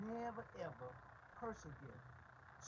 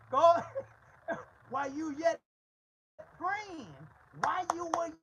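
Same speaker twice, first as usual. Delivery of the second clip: laughing preach; 11 semitones higher, 15 dB louder than usual. Real, not acted. Preaching at full force, laughing.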